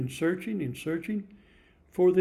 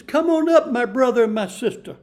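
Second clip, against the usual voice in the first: loud warm invitation; 5 semitones higher, 6 dB louder than usual. Acted. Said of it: "he says".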